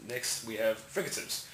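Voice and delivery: normal talking voice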